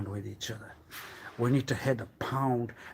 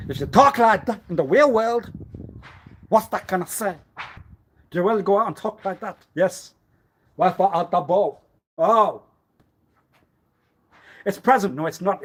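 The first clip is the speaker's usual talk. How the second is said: loud high challenge; 6 semitones higher, 9 dB louder than usual. Acted.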